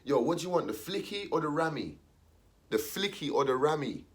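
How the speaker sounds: quick street seller pitch; shop seller voice. Retold or imitated. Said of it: "there's people on the phone like this".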